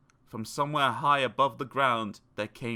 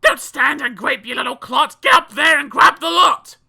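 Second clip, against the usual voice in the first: yelling out loud; 13 dB louder than usual. A narrator's reading. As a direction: shriek greedy orders at the boy. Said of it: "She yells out loud".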